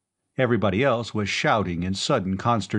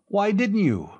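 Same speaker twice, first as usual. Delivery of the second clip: higher, pointed question; reproachful demand; 11 semitones higher than usual. A narrator's reading. Gatworth challenging Khan, higher and pointed. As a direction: press him for the reason.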